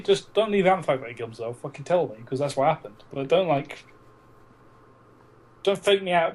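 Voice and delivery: ordinary delivery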